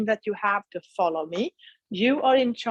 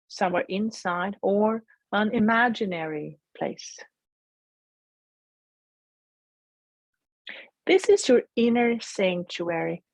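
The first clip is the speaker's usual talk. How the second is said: guided meditation. Acted. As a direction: gently guide them inward.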